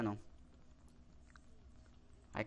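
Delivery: ordinary talk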